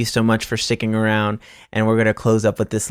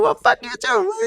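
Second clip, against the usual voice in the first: very high cartoonish voice firing it back; squeaky mock comeback. Answering the driver in traffic, squeaky cartoon retort. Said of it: "You're like".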